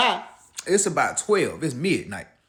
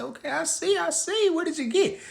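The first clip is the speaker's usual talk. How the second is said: suspicious; 14 semitones higher than usual. Retold or imitated. Wary reply to shopping bags.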